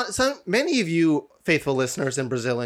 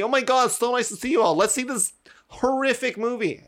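happy-go-lucky friends; 9 semitones higher than usual. Retold or imitated. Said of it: "all happy, go lucky".

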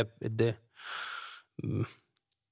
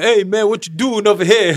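much higher and louder, swaggering English street talk; mock hip-hop greeting; 10 semitones higher, 11 dB louder than usual. Friend retelling how the host talked to the dog, high loud mock rapper voice.

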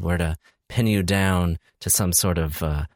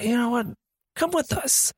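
mock invitation. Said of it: "So I was like".

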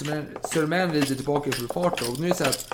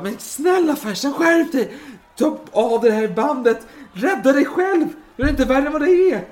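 high, loud, frantic begging; shrill-pitched acted desperation; urgent pleading; 11 semitones higher, 7 dB louder than usual. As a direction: implore him to save himself. Voices Werner pleading urgently with Fersen.

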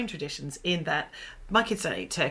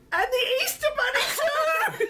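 shocked realisation. Retold or imitated. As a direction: wail it out like a kid losing Easter. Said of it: "then he went".

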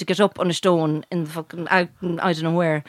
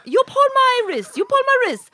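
high-pitched mimicked command; masseuse; 14 semitones higher than usual. Masseuse instructing client to pull, high mimicked order. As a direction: imitate her insisting, voice way up. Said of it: "She's pulling my".

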